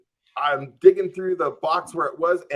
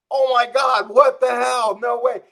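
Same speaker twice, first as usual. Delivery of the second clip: higher and louder, stunned; shocked disbelief; 9 semitones higher, 6 dB louder than usual. Retold or imitated. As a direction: blurt it out in amazed relief, loud and high. Cop drives off, relief.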